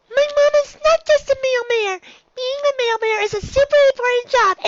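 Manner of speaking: a small filly defending her mom, hurt and loud; Dinky bristling. Acted. Little filly answers the bully about her mom, high and indignant.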